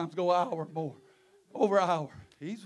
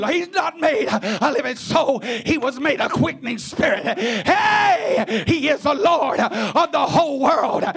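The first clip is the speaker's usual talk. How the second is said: raised, driving sermon voice; full-force preaching; 4 semitones higher, 4 dB louder than usual. Real, not acted. Preacher exalting the King of glory, impassioned shouting.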